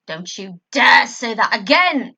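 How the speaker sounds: loud, slow furious roar; furious roar at Jack. Acted. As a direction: roar it with fury. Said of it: "I roared out".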